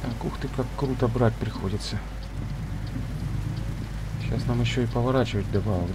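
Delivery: ordinary talk, nothing marked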